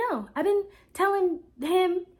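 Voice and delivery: high, slow exasperation; exasperated pushback. Retold or imitated. Frustrated denial.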